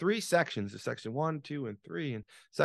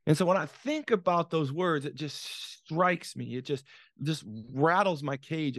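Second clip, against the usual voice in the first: usual speaking voice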